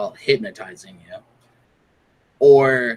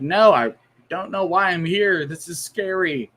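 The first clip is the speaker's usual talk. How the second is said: put-on scared kid; 7 semitones higher than usual. Patient voiced as scared kid.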